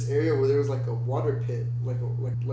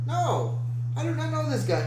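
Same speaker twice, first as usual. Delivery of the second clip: much higher, protesting refusal; retold emphatic refusal; 12 semitones higher than usual. Driver refusing a stranger a ride, retold emphatic protest. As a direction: re-enact an incredulous objection in a high pitch.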